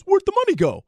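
high, loud, baffled where'd the money go; mock bewildered outcry. Host mocking voters who lost the money, high baffled outcry. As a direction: squeal it like a shocked sheep. Mocking everybody's confused question.

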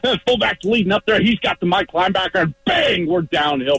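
high, pumped-up play call; live play-by-play. Acted. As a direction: narrate the play like you're in it. Acted play-by-play of a run play.

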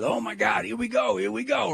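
high, excited gush; squealing mock excitement over snow. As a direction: ham up the giddy commuter. Mimicking excited strangers on bus.

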